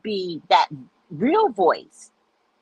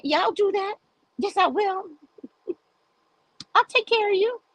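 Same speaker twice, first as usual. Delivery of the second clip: much higher, coy and teasing with a laugh; flirty high promise; 10 semitones higher than usual. Pretend. Wife promising to pamper her man, coy and teasing. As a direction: tease him sweetly, laughing.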